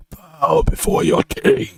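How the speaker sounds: very high, strange-timbre king command; megalomaniac rant. Pretend. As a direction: command them to kneel, unhinged. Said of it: "I don't have his accent down fully".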